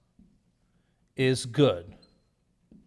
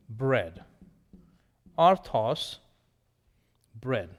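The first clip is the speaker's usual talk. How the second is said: ordinary delivery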